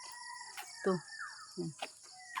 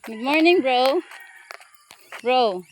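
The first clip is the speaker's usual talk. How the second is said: playful call; 3 semitones higher, 5 dB louder, slower than usual. Real, not acted. Calling greeting to a dog.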